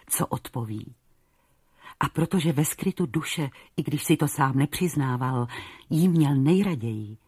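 ordinary delivery, nothing marked

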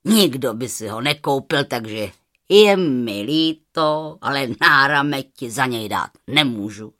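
low, contemptuous merchant; low scorn, leaning hard on words; scornful. A narrator's reading. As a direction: dismiss it with lofty disdain. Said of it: "řekl pohrdlivě".

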